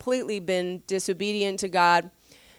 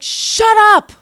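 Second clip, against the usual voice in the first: very high and loud, fed-up outburst; exasperated shout; 12 semitones higher, 12 dB louder than usual. Retold or imitated. Samuel fed up with Saul, loud high shout. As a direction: cut him off, totally done. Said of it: "he's just like".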